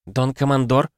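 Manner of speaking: lightly voiced unsure woman; uncertain woman. A narrator's reading. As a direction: address the commander hesitantly. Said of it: "неуверенно проговорил женский голос".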